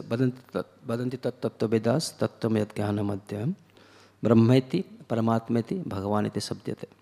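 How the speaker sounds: a lightly chanted scriptural recitation; Sanskrit verse recitation. Acted. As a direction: intone the Sanskrit verse in a measured, chanting read.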